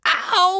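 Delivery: very high, loud howl of pain; drawn-out high "ow"; long pained yell. A narrator's reading. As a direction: yelp as if struck by a cane. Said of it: "he yelled".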